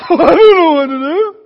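loud, high, hammed-up wail; mock helplessness. Police officer faced with trouble, mock helpless wail. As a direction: play a hopelessly flustered police officer. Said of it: "Or a police officer going".

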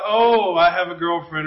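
lightly acted lovestruck boy, low; mock love glow. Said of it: "they have the glow about".

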